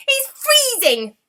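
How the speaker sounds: the goblin king squeals at the icy touch, slowly; high slow alarmed squeal from a cold nose; squeaking goblin king. Acted. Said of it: "squeaked the king".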